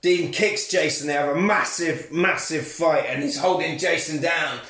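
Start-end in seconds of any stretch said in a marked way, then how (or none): none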